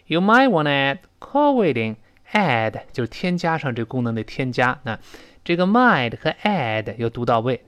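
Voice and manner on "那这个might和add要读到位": ordinary delivery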